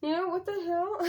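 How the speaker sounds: high-pitched indignant telling-off; outraged confrontation. Retold or imitated. Mom telling off the bus driver who yelled at her son, outraged. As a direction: demand an answer, pitched right up in outrage.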